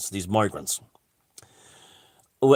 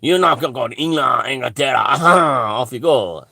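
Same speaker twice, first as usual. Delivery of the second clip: quick, hammed-up French accent; mock French official. French official sending migrants to England, fast mock French accent. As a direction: rattle it off like a shrugging French guard. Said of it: "you know, this kind of thing".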